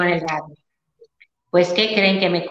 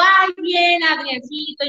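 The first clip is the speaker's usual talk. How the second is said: high, bright-toned retold greeting, a bit sugary; lightly mock-sweet hello to the producer; sweet sing-song greeting; 7 semitones higher, much slower than usual. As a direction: chirp it like all is well. Her cheerful reply to producer.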